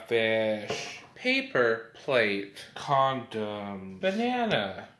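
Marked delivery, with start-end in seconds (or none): none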